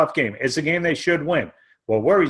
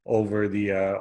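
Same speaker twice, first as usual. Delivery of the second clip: ordinary talk, nothing marked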